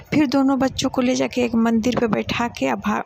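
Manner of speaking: usual speaking voice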